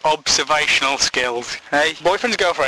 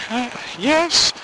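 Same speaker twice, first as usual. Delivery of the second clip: put-on high old lady voice; 10 semitones higher than usual. Widow answering, high.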